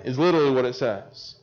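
ordinary talk

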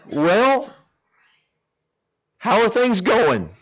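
very high, loud, slow with a laugh; awkward amused small talk; awkward laughing question. Retold or imitated. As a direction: cover the shock with a laugh.

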